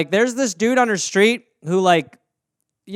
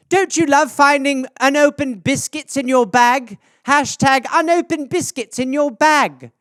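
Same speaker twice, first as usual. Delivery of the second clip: higher mock-mom voice, the hashtags slow; imitating mom's posts; 6 semitones higher, 3 dB louder than usual. Mother posting about her placemats, in a higher slow mock voice. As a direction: play a delighted mother reading her post aloud. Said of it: "It's literally it".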